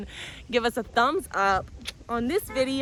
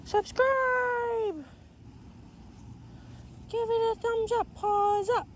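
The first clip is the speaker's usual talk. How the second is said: high, eager pretend dog voice; the dog answering her owner in a squeaky voice; voicing the dog; 10 semitones higher, 3 dB quieter, slower than usual. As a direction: speak for the puppy, chirpy and high. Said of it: "What do you have to say, Moonlight?".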